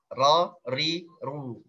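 "ro, ri, ru" with the r trilled lightly. Shown as a demonstration. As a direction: roll the tongue tip on "ro, ri, ru". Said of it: "getarannya itu satu sampai tiga".

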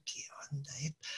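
whispered puzzled onlookers. Retold or imitated. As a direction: hiss it under your breath like a stranger in line. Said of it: "la gente me miraba como diciendo".

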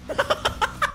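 high, airy burst of laughter; laughing. Real, not acted. Streamer cracking up between game-time calls, high laughter. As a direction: laugh in a high quick run.

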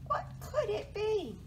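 high, wondering mouse voice; high-pitched storybook puzzlement; startled mouse. A narrator's reading. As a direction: ask it high, like a little mouse. Mouse wonders after squeaking.

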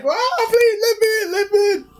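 very high, squealing wail; hammed-up panicked begging; mock desperate pleading. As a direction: beg to be let in, shrieking in falsetto. Acts out man begging for shelter.

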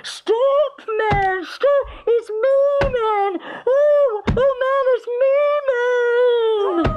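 high, loud, desperate wailing; frantic crying plea. Acted. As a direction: beg him to stop, terrified. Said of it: "His wife cried".

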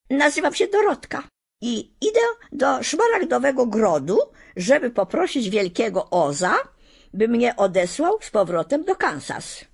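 wyżej, głos małej dziewczynki; dziecięca, szczera Dorotka. Acted. Dorotka voiced by narrator. (Dorotka przedstawia się strachowi, dziecięcym głosem)

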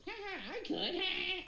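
very high goblin squeal with laughter; cackling goblin. Acted. Goblin reacting to the strangers, high cackling goblin. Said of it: "The other goblin kinda goes".

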